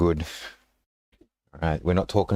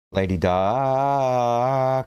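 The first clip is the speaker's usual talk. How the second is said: dramatic announcement; 2 semitones higher, much slower than usual. Acted.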